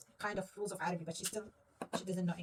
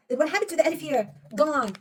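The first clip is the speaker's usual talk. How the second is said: ordinary talk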